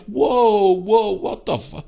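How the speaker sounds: high, drawn-out whoas; startled protest. Retold or imitated. Shopper startled as woman turns on her, startled protest. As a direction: recoil in disbelief, stretching the words. Retelling being ripped into.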